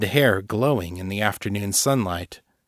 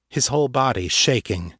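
usual speaking voice

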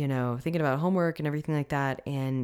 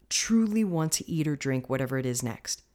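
ordinary talk, nothing marked

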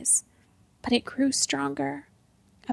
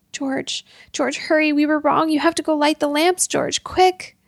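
frantic, higher and louder plea; panicked urging; 3 semitones higher, 4 dB louder than usual. Acted. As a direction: beg him to hurry, terrified. Monsters attack, urging George.

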